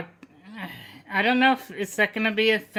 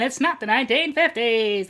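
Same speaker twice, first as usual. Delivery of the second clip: ordinary talk, nothing marked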